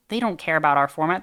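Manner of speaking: ordinary talk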